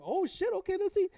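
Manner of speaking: high-pitched startled quote; imitated hyped reaction. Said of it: "And he gets up like".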